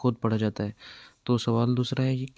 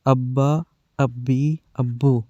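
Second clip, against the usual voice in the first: slow, spelled-out syllable drill; firm, paced spelling recital for children; drill reading; 5 dB louder, much slower than usual. Shown as a demonstration. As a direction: read each sound firmly with pauses, like a teacher drilling kids. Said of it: "شروع سے پڑھتے ہیں".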